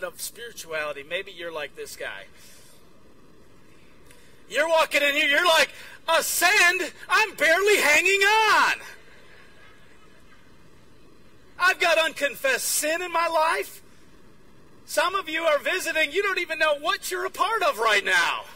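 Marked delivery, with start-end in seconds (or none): 6.1-13.8 loud, high, slow strained plea; hammed-up desperation, words drawn out; overwhelmed protest. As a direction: act someone desperate at being asked to climb. Acting a struggling, overwhelmed church member.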